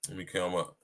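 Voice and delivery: ordinary delivery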